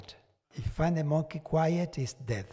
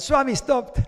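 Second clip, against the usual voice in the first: excited call; 10 semitones higher, 9 dB louder than usual. Retold or imitated. Repeats call to Swami.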